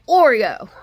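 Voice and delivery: loud, high name call; scolding the cat. Retold or imitated. Owner scolding her cat's spirit, loud name call. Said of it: "I'm like".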